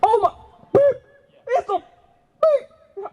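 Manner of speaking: high-pitched, astonished outburst with beeps; reenacted shock. Shown as a demonstration. Team seeing the print, reenacted shocked outburst. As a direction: squeal it out, bleeping the swears. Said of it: "Let's just reenact it".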